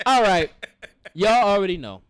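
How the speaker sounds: y'all already know, said while still cracking up; laughing. Real, not acted. Host wrapping up the show to listeners, laughing.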